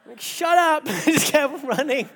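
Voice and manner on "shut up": mock retort. Retort to old man's warning.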